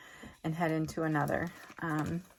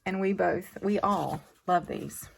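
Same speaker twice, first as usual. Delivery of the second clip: ordinary talk, nothing marked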